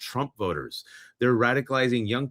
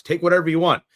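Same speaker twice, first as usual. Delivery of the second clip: mock generous shout; 5 semitones higher, 8 dB louder, faster than usual. Politicians handing DHS blank checks.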